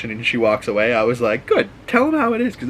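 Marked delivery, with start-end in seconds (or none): 1.5-2.6 much higher, excited cheer. Real, not acted. Viewer cheering the girl telling off the thug, high excited cheer. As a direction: egg her on like a fan.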